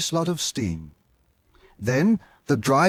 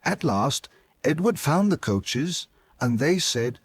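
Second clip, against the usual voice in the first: usual speaking voice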